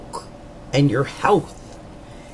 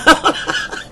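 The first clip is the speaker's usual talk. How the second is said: breathy chuckle; quick airy laugh; light laugh; 11 semitones higher than usual. Real, not acted. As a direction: laughs at her own joke. Joking about breakdown.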